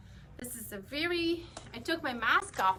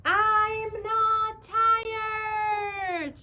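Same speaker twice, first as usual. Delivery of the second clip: drawn-out high sung scream, much louder; 9 semitones higher, 7 dB louder, much slower than usual. Acted. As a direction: wail it long and loud like a tantrum. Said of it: "the pigeon is screaming, screaming out loud".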